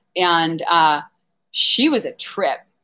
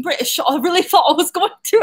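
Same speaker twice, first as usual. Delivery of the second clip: put-on slipping into a playful British accent, higher toward the end; 7 semitones higher than usual. Livestreamer confessing a podcast fear to viewers, mock British accent. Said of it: "I do British accents".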